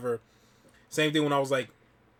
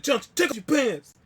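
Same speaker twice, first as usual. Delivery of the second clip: high, loud mimicry of a brother; imitated urging; 11 semitones higher, 5 dB louder than usual. Brother pressing him to comply, imitated high, loud urging. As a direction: push the order out high and insistent.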